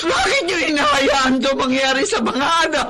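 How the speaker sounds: sobbing, wailing for her son; grieving cry. Acted. Mother grieving her son Mark, sobbing cry. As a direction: break down over the lost child.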